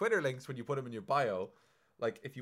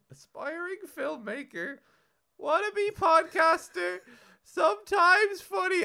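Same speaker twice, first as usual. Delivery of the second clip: high, slow, louder mock-grand announcement; grand mock reading; 10 dB louder, slower than usual. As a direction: read it like a pompous trailer voice. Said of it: "And it was, like".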